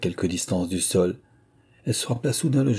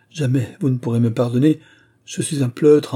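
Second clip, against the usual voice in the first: higher, louder self-reproach; horrified confession; 5 semitones higher, 5 dB louder than usual. A narrator's reading. Young man calling himself a coward before her, mortified. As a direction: exclaim it, appalled at yourself. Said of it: "confondu par l'horreur de ce qui lui restait à avouer".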